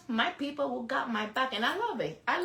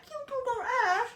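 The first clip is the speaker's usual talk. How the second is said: much higher, squeaky put-on voice; mock squeaky voice; 13 semitones higher, 3 dB quieter than usual. Mocking a commenter in a high squeaky voice. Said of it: "I don't be like".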